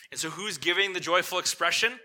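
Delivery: ordinary talk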